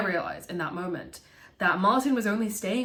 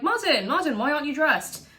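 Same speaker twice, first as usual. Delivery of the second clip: high, louder eager call; hyped calling out; 8 semitones higher, 4 dB louder than usual. Retold or imitated. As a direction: call him twice, all excited to go out. Hyped Thomas calls to Martin.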